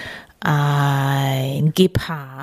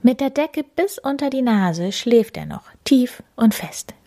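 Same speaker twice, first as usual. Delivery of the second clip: plain reading voice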